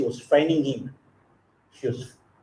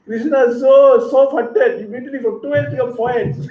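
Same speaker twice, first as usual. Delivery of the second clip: higher, laughing through the words; laughing fondly; 9 semitones higher, 4 dB louder than usual. Real, not acted. Preacher telling how Krishna comforted Rukmini, laughing fondly. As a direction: delight breaking into laughter. Tender tale, laughter heard.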